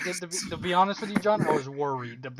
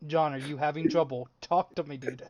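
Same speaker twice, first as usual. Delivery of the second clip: ordinary delivery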